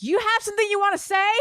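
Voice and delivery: high, fast confrontational challenge; snapping challenge. Retold or imitated. To trash-talking guys, a high fast challenge. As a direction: square up to the trash talkers. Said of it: "Something snapped in me".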